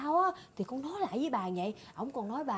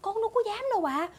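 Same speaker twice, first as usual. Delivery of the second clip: high-pitched protest of innocence; alarmed denial; 8 semitones higher, faster than usual. Acted. Maid accused of theft by her mistress, alarmed denial. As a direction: blurt out a hasty denial. Maid denies stealing the silver.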